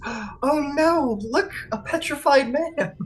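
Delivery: high, startled cry; alarmed discovery. Acted. Thalia spotting a petrified man, high and startled. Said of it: "she goes, gasps".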